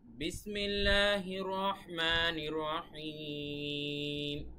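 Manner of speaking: slow Quranic chant; Quran recitation. Shown as a demonstration. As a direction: draw out each phrase like recitation. Recites Quran opening slowly.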